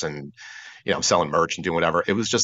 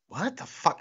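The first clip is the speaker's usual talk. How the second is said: high, soft, incredulous question; mutely appalled inner reaction, pitched up; baffled disbelief; 11 semitones higher, 5 dB quieter than usual. Retold or imitated. Retold inner bafflement at casual response.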